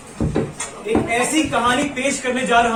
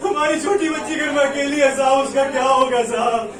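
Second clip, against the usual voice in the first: wailing appeal for mercy; frightened, tearful-sounding begging; desperate pleading. Acted. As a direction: beg to be let go, drawing out the last words. Labourer begs to go home.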